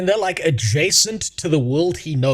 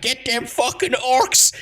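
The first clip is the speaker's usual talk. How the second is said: lightly hammed Alabama twang, slow and pitched up; mock Alabama accent; 9 semitones higher, slower than usual. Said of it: "an Alabaman accent".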